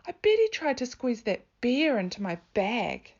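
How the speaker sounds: loud, high accusing shout; angry big sister. A narrator's reading. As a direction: yell it at Mum to get him in trouble. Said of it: "she said".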